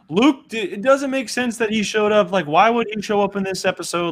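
high-pitched imitation of complaining fans; mock objecting fans. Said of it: "all of you out there who are saying".

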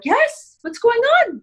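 high, loud, alarmed yes; bride's mom answering. Retold or imitated. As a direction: squeak out the worried mother's reply. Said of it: "And she was like".

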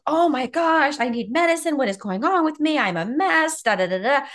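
high, loud hammed-up panic; mock freak-out. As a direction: play the panicked self she avoided. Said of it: "instead of being freaked out".

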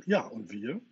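loud, eager call. A narrator's reading. Newly woken brothers call to Peter to be included, calling out. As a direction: pipe up loudly to be included. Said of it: "riefen die Jungs".

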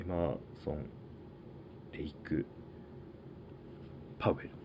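soft, halting, with long pauses; tentative low-volume murmur; small unsure voice. Real, not acted. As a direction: say it quietly, as if unsure of the facts. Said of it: "ちっちゃい声で言ってしまいました".

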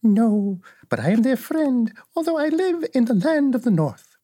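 put-on high little old woman's voice, matter-of-fact; kindly old witch. Little old woman shows Dorothy the witch's feet, cheerfully high.

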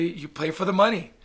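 normal talking voice

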